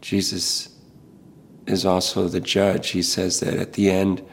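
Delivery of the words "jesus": ordinary delivery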